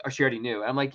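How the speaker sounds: ordinary talk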